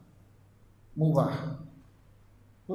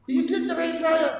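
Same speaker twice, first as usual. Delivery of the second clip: much higher and louder, aggrieved wife; a wife telling the ustadz she was divorced, higher and upset; 13 semitones higher, 4 dB louder than usual. Acted. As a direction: blurt it out like a hurt wife. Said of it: "dengan baper duluan".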